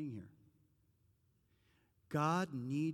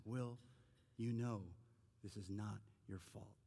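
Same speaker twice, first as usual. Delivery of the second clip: quiet tender insistence; 4 semitones lower, 7 dB quieter than usual. Retold or imitated. Therapist softly, final time.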